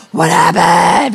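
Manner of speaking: loud, slow drawn-out What happened; shouted question. Retold or imitated. Members shouting to hear about the golf cart incident, slow and loud. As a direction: call out for the gossip, stretched. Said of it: "people were like".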